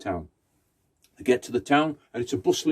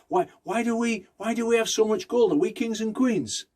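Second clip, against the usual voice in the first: put-on high, curious child voice asking; child's question; 9 semitones higher than usual. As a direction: raise the voice like a wondering little kid. Child asking relative, acted voice.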